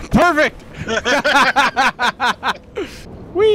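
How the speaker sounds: high, excited laugh after a nervy jump; scared giddy laughing. Real, not acted. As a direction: laugh giddily as you leap off. Jumps off after countdown.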